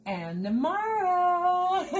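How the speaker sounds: high, stretched-out sing-song; playful sing-song. Real, not acted. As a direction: draw it out high and teasing. Sing-song joke about leftovers.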